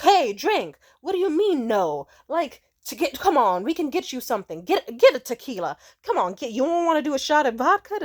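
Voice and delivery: loud, high, insistent pushing; mocking loud drunk guys pushing shots; pushy men. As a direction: badger her to drink, loud and high. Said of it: "they'll be like".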